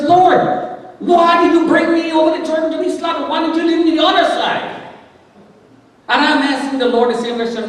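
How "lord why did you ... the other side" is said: Joshua. Acted. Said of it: "Joshua says".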